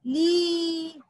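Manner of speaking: ली held long on one note; long vowel. Shown as a demonstration. Teacher repeating the syllable for children, long-held ली. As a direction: sustain the ee in ली. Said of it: "उसका उच्चारण होगा".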